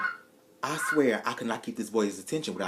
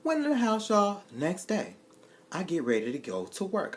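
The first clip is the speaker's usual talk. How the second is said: ordinary talk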